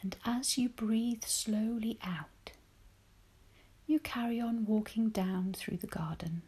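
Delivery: normal talking voice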